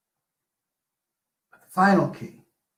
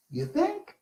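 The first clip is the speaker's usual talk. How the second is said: a high, quiet you think; teasing aside; 13 semitones higher, 6 dB quieter than usual. Real, not acted. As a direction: toss it off slyly to the crowd.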